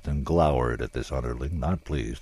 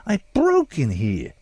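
slow, higher, puffed-up honest citizen; mock dignity; 11 semitones higher, 6 dB louder, slower than usual. A narrator's reading. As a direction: draw yourself up, offended and slow. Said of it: "drawing himself up with what was supposed to be an honest citizen's dignity".

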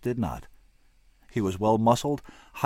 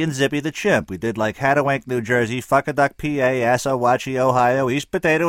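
louder, animated musician spinning a yarn; Hatwell rambling story; 3 semitones higher, 8 dB louder than usual. Acted. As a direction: rattle off the tour tale with gusto. Hatwell tells his tour story.

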